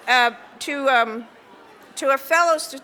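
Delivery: usual speaking voice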